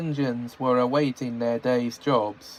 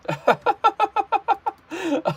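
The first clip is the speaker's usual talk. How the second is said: high burst of laughter. Real, not acted. Laughs after story.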